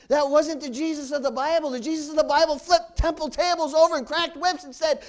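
loud fervent sermon delivery; preaching at full force. Acted. Preacher describing Jesus to congregation, preaching loud. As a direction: drive it home to the pews.

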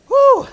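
short, high, loud "woo"; worked-up whoop. Real, not acted. Preacher worked up from his own sermon, whooping. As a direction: let out a whoop of release. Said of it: "I get too worked up saying that".

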